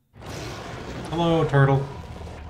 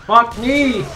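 excited outburst; 8 semitones higher, 4 dB louder than usual. Real, not acted.

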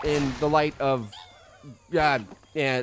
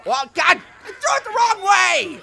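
much higher, louder squeak of dismay; dismayed squeal; 6 dB louder than usual. Real, not acted. Gamer throws grenade wrong way, high dismayed yelp. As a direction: yelp at your own mistake.

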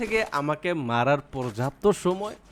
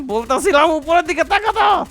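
much higher, louder teasing squeal; squealed taunt; 13 semitones higher, 7 dB louder than usual. Acted. Streamer taunts the enemy overhead, high squeal. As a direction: shriek it like a cartoon voice.

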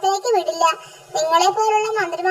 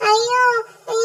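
acted wailing; 4 semitones higher than usual. A narrator's reading. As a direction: wail out in mock distress. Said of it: "ഇവിടെ ആരാ നിലവിളിച്ചത്". (അഭിനയിച്ചുള്ള നീണ്ട നിലവിളി)

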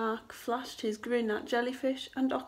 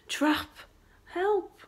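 high, loud storybook alarm; crying for help; 8 semitones higher, 5 dB louder than usual. A narrator's reading. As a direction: call out like trapped fish. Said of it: "cried the fish".

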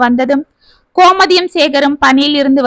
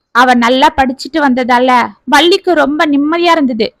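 usual speaking voice

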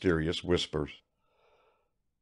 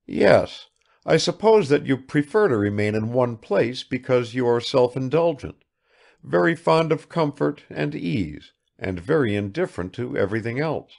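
raised, lightly acted frank voice; higher dialogue voice, a touch blunt; lightly voiced Bazarov, blunt; 6 semitones higher, 5 dB louder than usual. A narrator's reading. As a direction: lightly play a man giving his blunt verdict.

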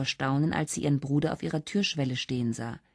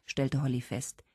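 plain reading voice

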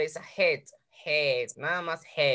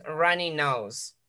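slow model phrase; much slower than usual. Shown as a demonstration. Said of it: "teacher models new vocabulary".

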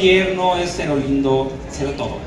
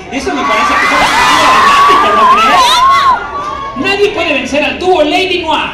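much higher and loud, theatrical; dramatic superhero; 8 dB louder than usual. Shown as a demonstration. Cat Noir boasting to Ladybug, loud and dramatic. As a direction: ham it up like a hero. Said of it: "tono de superhéroe".

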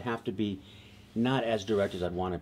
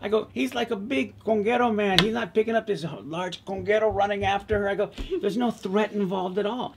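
much higher, incredulous pushback; stun-faced disbelief, pitched way up; shocked protest; 11 semitones higher, 5 dB louder than usual. Retold or imitated. Rick protesting the writer's fear of the conguero, shocked. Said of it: "I'm shocked".

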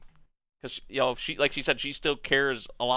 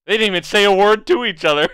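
laughing; 6 semitones higher, 9 dB louder, faster than usual. Real, not acted. Laughing while reacting to scene.

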